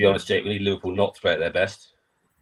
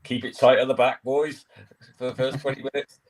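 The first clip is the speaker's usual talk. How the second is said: lightly voiced Moyes urging the boys to keep it tight; David Moyes team talk; 5 semitones higher than usual. Manager telling his players in the dressing room, mildly raised team-talk voice. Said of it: "he will say something like".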